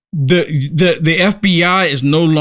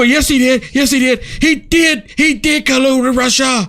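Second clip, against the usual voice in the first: mocking insistence; 6 semitones higher than usual. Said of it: "And they go".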